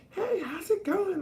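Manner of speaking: very high, slow, bubbly hey; mock a chirpy LA stranger; high-pitched LA greeting. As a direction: falsetto valley greeting. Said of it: "high pitched like that".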